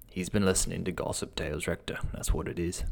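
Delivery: soft, uneasy muttering; quiet, worried grumble to the rector. A narrator's reading. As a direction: mutter it nervously under your breath. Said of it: "Wyke muttered uneasily".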